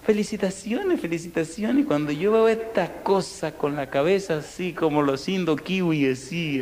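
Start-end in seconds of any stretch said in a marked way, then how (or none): 0.1-1.8 high, cheery congratulations; very happy. Retold or imitated. Doctor greeting the new father with the baby, very happy. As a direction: chirp it like delighted good news. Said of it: "muy feliz".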